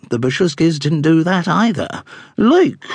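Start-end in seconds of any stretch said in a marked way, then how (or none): 2.4-2.8 haughty dowager duchess. A narrator's reading.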